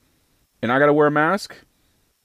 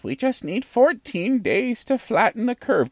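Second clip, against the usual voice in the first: much higher-pitched quoted voice; high-voiced imitation of a health official; Doctor Birx; 9 semitones higher than usual. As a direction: quote it in a raised, put-on voice. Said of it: "Remember Doctor Birx?".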